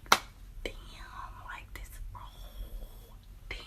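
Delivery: barely audible, breath-only whisper; stunned whisper. Acted. Storyteller taking in the positive test, stunned whisper. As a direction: whisper it slowly in disbelief.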